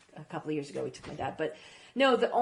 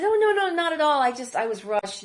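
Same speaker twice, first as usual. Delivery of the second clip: hasty reassurance; 8 semitones higher, 6 dB louder than usual. Retold or imitated. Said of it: "But I said".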